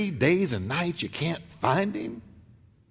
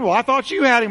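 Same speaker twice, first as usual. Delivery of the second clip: higher, louder accusing blame; rising blame; 7 semitones higher, 4 dB louder, faster than usual. Acted. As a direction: throw the blame back, higher. Parents' panicked blame quarrel.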